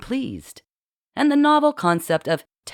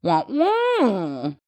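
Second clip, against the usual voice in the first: sing-song fake French nonsense, higher; boy mocking a French accent in a higher sing-song; 6 semitones higher than usual. A narrator's reading. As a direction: lampoon a French accent lyrically. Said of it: "lyrical tone he felt imitated Marcel's accent".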